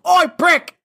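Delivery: high, loud imitated yell of Oi, prick. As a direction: bellow it across the room like Ed. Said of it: "Ed just yells".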